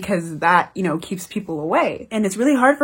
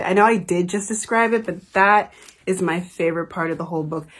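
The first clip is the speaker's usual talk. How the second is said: ordinary delivery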